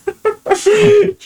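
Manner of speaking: high burst of laughter; laughing. Real, not acted. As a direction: crack up mid-story. Cracks up at own story.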